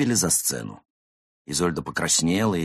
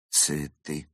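understated, bitter echo; a touch of wry disappointment; bitter scoff; 3 dB quieter than usual. Acted. Slighted actress echoing the cat's excuse, bitter. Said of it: "горько усмехнулась гусыня".

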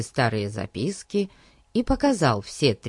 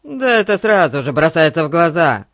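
loud gruff retort; grumpy, forceful outburst; grumbling; 3 semitones higher, 8 dB louder than usual. A narrator's reading. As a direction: insist it loudly, annoyed. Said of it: "буркнул он".

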